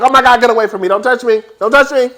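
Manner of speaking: high, loud mock-horrified shrieking; mocking Giselle. Reviewer acts out Giselle shoving Wendy away, high and loud. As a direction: recoil and shriek it in disgust. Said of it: "Giselle like".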